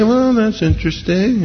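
polite brush-off. Acted. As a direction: brush off the preacher with feigned interest. Acting out polite brush-off from listener.

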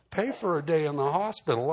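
arguing back at the doctor, indignant; indignant echo. Retold or imitated. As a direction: repeat it back in disbelief.

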